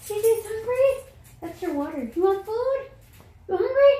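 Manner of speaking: high sing-song talk to the dog; pet voice. Real, not acted. Talking to her dog in a high pet voice. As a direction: coo up high like to a puppy.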